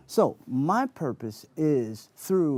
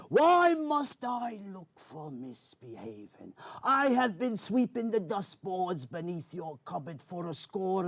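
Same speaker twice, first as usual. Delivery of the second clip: Captain Barbossa pirate voice; 4 semitones higher than usual. Acted. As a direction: declaim like a swashbuckling pirate. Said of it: "characters was Captain Barbosa".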